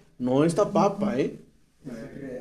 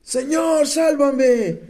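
desperate cry; 8 semitones higher, much slower than usual. Shown as a demonstration. Preacher to congregation, demonstrating Peter's desperate high cry. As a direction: act out the cry so they can imagine it. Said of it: "¿Se imaginan ese grito de desesperación?".

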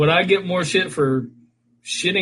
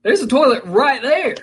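high mock friend voice; friend's voice; 8 semitones higher than usual. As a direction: imitate your buddy, higher. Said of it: "So your boy was like".

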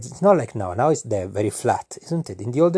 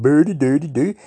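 mock Swedish gibberish; 5 semitones higher, 5 dB louder than usual. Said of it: "speak like this".

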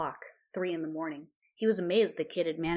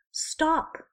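high pleading cry; 11 semitones higher, 6 dB louder than usual. A narrator's reading. Hermione pleading with Ron, high pleading cry. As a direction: beg him to stop. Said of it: "she pleaded".

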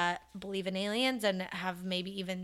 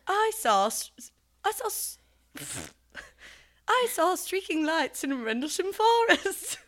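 attempted British accent; 9 semitones higher than usual. Shown as a demonstration. Repeating the co-host's sentence on request, attempted British accent. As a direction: put on a bad British accent with false starts. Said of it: "try and say it in a British accent".

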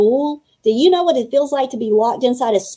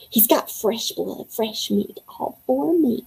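hungry hushed gloat; 6 dB quieter than usual. Acted. Hungry vampire gloating over prey.

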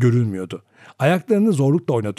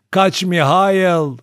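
high, loud, drawn-out cry; shouted warning; 6 semitones higher, 6 dB louder, much slower than usual. A narrator's reading. Grandmother falling as she warns her son, crying out. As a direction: stretch the call out slowly and loudly. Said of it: "diye bağırdı".

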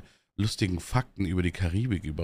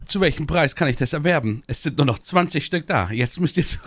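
infomercial; 6 semitones higher, 4 dB louder than usual. Pretend. Joining the mock ad.

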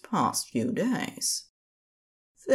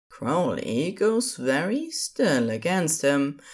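plain reading voice

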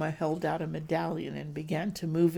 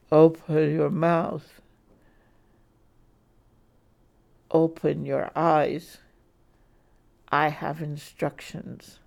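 slow commanding voice; 7 dB louder than usual. Acted. Kidnapper commands drugged victim.

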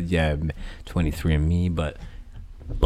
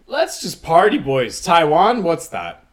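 mock cynical news; 6 semitones higher than usual.

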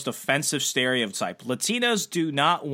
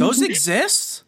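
stunned disbelief; 7 semitones higher, slower than usual. Real, not acted. Disbelief at redheaded Puerto Ricans.

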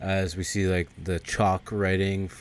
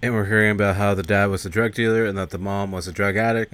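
usual speaking voice

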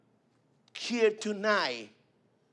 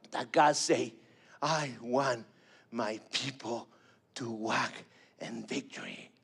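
tender; 8 semitones lower, 7 dB quieter, slower than usual. Acted. Tender sermon close.

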